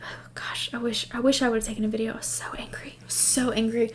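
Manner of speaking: whispered confession of anger; exasperated whisper. Real, not acted. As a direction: hush it like a guilty secret.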